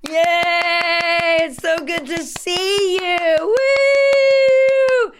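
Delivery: high, slow gushing "Yay!"; over-the-top happy greeting. Shown as a demonstration. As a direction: beam at them ear to ear. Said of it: "You're like".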